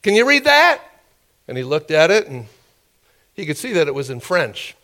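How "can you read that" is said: high, loud testing question; challenging official. Retold or imitated. Officials handing him another piece of paper, mock challenge. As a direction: pitch it right up and dare him to read.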